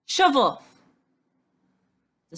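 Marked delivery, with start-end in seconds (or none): 0.1-0.8 loud, tearful yell to be left alone; angry shout. A narrator's reading. Natasha shouting at Stan to go away, angry shout. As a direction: bark it, wanting him gone. Upset girl snaps at boy.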